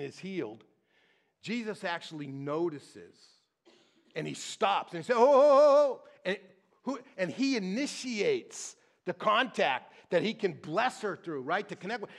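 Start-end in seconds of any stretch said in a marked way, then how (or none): none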